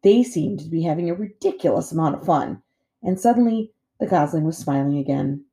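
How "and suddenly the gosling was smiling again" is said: plain reading voice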